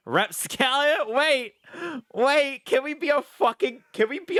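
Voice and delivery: high, loud, drawn-out excited cry; excited shout. Real, not acted. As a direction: yell it out with real glee. Excited pick of character class.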